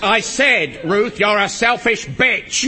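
loud, slow, emphatic accusation; angry insult. Acted. Husband insulting his wife on the phone, angry. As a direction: spell it out loudly down the phone.

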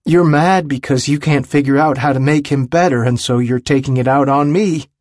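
high, heated accusation; remembered yelling. A narrator's reading. Willem remembers yelling at Andy years ago, heated. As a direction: hurl the blame at him. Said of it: "remembers yelling at Andy".

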